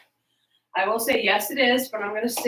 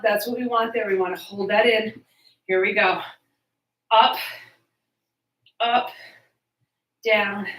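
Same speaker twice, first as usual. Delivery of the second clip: usual speaking voice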